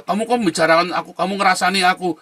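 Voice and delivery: loud, high, indignant accusation; mock-angry quoted outburst; angry retort. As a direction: act out someone snapping back, offended. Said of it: "malah marah-marah dia".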